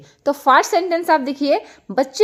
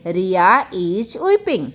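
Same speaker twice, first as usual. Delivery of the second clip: slow, careful model sentence; slow teacher demo; 5 semitones lower, much slower than usual. Shown as a demonstration. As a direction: lay it out slowly for the class. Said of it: "teacher model sentence". (धीमे से समझाते हुए)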